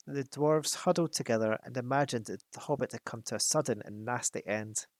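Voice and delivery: ordinary delivery, nothing marked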